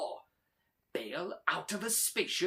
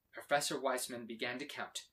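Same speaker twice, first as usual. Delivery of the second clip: usual speaking voice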